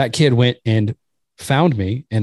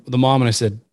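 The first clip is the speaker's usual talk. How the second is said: ordinary talk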